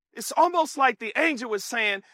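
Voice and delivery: usual speaking voice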